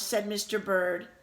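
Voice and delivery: usual speaking voice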